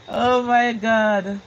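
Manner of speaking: high, loud, stretched-out exclamation; drawn-out exclamation. Real, not acted. Reacting to a viewer's chat message, drawn-out exclamation. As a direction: exclaim it slowly, pitched well up.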